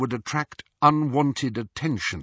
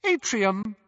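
high, cool announcing woman; cool witch's voice; 9 semitones higher than usual. A narrator's reading. Lift voice calls the floor, cool. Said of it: "said the witch's cool voice".

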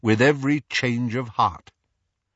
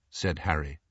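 ordinary delivery, nothing marked

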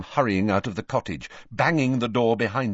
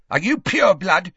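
loud, high, pointed demand; belligerent, raised-voice interrogation; suddenly aggressive; 10 semitones higher, 7 dB louder than usual. A narrator's reading. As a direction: fire the question as a challenge. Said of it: "suddenly aggressive".

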